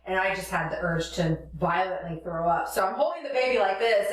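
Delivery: ordinary talk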